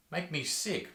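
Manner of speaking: sudden hushed disgust; quiet, hammed-up revulsion; mock disgust. Drop after the mock tirade.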